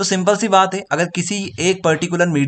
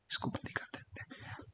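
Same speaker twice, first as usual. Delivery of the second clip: muttered aside; 24 dB quieter than usual. Real, not acted. Aside while switching off.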